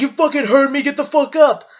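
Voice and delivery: heated retold command, not a full yell; aggressive tone. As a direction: imitate an angry man ordering someone out. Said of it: "talk to me in that fucking tone".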